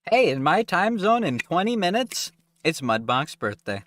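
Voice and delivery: high, loud and eager; excited announcement. Real, not acted. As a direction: burst out with the happy news. Excited birthday announcement to chat.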